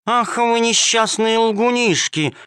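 high, slow indignant cry; angry exclamation. Acted. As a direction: exclaim it, truly cross. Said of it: "воскликнула кошка-хромоножка, рассердившись не на шутку".

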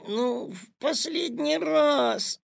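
high, drawn-out whine; whining plea. A narrator's reading. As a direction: beg like a wheedling child. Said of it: "законючил Петя".

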